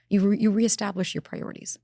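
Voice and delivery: ordinary talk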